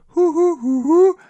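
high loud hoots; owl call. Retold or imitated. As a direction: hoot high like the owl starting. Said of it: "it starts to go".

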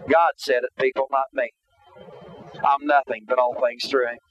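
normal talking voice

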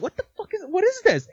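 confused. Retold or imitated. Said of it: "I'm like".

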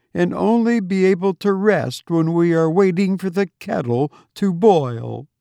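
put-on fretful young wife. A narrator's reading. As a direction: lament the housework ahead, petulant. Laura's voice, complaining.

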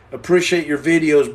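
ordinary talk

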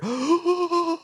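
high staged gasp; mock gasp. Recapping the twist for co-hosts, hammed-up gasp. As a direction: gasp theatrically for effect. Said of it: "dramatic pause".

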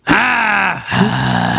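gravelly shout. Retold or imitated. Imitating Loggia's gravelly shouts.

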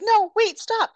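high, urgent cry to stop; acted high-pitched panic; alarmed plea. Heroine stops him attacking Jason.

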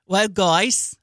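a lightly voiced Aussie "Well, guys"; rising Australian lilt on "guys"; Australian accent. Imitating the actor's Australian greeting for a friend. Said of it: "That was Australian".

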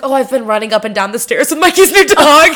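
high, loud fake-innocent excuse; overly cheery excuse. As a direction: sell the lie brightly. Lying to mom, excited cover.

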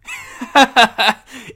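loud surprised laugh; a sudden loud laugh; laughing burst. Real, not acted. Laughing at bad smell.

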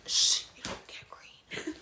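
whisper. Real, not acted. Teasing hush during game.

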